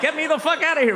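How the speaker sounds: high panicked mock cry; mock walker. A walker through Orthodox neighborhoods, high panicked mock plea. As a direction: squeal to get out. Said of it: "walking like".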